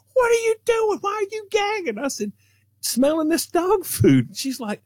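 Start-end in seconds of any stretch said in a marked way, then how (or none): 0.1-2.0 much higher, alarmed mom; imitating his mother catching him gagging; a high mock mom voice calling out; mom's voice. Mom voiced in retold story. 2.8-4.4 put-on child voice. Child self answering mom.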